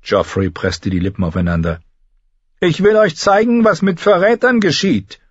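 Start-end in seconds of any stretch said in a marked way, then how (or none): 2.6-5.2 high, loud cruel king; cruel boy-king. A narrator's reading. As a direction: threaten her with a child's glee. Joffrey threatens Sansa. (hoher, lauter grausamer Knabenkönig)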